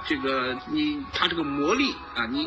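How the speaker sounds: ordinary talk, nothing marked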